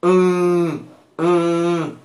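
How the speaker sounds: "Mm" held as a steady nasal hum; nasal sound. Shown as a demonstration. Teacher shows learners the Portuguese nasal sound, nasal "Mm". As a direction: hum "Mm" through the nose, twice. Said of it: "Es un sonido nasal".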